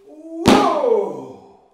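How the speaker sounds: shouted high whoa; bear's big swing cry; loud whoa. Acted. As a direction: yell it as the stick swings. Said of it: "And then he goes".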